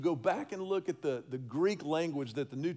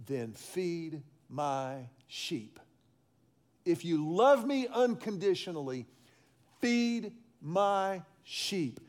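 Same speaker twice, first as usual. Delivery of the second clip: solemn command; slower than usual. Acted. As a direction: lay out each word slowly and gravely.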